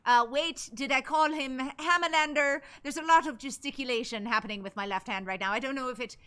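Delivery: put-on comic Irish accent. Streamer doing requested accent for viewers, Irish accent. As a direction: talk in an improvised Irish accent. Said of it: "when I go into the Irish accent".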